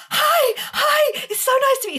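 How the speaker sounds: very high, loud gushing greeting; puppy-dog excitement. Retold or imitated. Fan runs up to her idol, hammed-up excitement. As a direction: imitate an overexcited fan bounding up. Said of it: "I am like this puppy dog".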